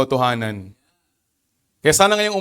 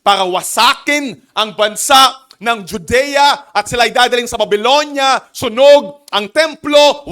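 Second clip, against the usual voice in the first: rising, louder preacher's cry; fervent preaching; 7 semitones higher, 6 dB louder than usual. Acted. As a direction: preach the destruction with full force. Preaching at full force. (masigasig na pangangaral)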